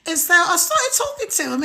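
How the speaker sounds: high-pitched put-on British accent. Shown as a demonstration. Livestreamer telling viewers about a live match, British accent. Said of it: "putting on my British accent".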